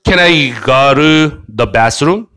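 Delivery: "go to" tapped into "ga-ru" within the question; fast linked pronunciation. Shown as a demonstration. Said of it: "얘를 빨리 발음하면".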